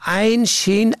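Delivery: ordinary talk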